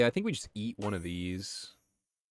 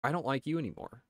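mock disdain; 9 semitones higher, faster than usual. Mock scolding the unit.

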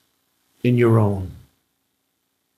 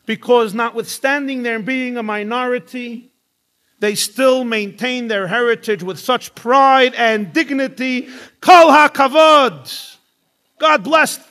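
high, building, louder acted praise; gushing admiration; 11 semitones higher, 6 dB louder than usual. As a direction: swell with admiration to a loud high peak.

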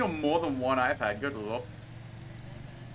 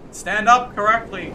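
higher, louder, slow scolding yell; 6 semitones higher, 8 dB louder than usual. Real, not acted. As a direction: drag it out as a loud order. Yelling at toppled car.

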